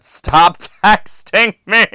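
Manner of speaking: loud, high, slow comic shout; exasperated yell. Acted. Telling a persistent texter to quit, exasperated yell. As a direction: yell it word by word, high and hammed-up. Reading exasperated reply, yelling.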